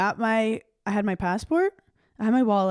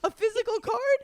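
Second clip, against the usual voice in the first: incredulous; 11 semitones higher than usual. Retold or imitated. Shocked at the DMV clerk.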